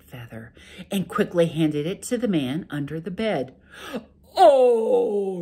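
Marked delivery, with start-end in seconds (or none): 4.4-5.4 loud, high pained ogre cry; loud startled ogre yelp; ogre yell. Acted. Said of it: "yelled the ogre".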